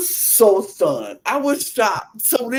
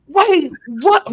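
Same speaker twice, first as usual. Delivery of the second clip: stunned wait what; 7 semitones higher, 4 dB louder than usual. Retold or imitated. Reacting to the three count.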